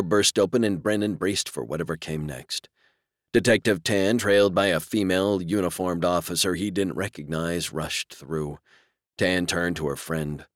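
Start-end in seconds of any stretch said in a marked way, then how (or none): none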